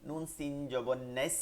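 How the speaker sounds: ordinary talk, nothing marked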